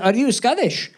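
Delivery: lightly imitated Scottish accent; retold question with a Scottish lilt. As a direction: ask as a Scottish customer. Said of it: "had a thick Scottish accent".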